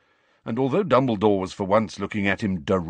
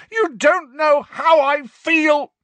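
slow, much higher and louder roar; 8 dB louder, slower than usual. A narrator's reading. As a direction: roar it with all his pain. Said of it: "Harry roared".

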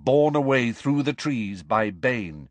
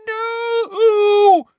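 very high, loud, long-held shriek; 10 dB louder than usual. Acted. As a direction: shriek it out in panic. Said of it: "he heard Umbridge shriek".

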